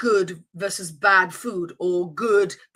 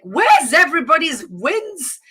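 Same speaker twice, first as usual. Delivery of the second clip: louder, high, drawn-out mock complaint; retold bewilderment; 6 semitones higher, 6 dB louder than usual. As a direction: stretch out the wondering question, high and loud. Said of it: "I was just like".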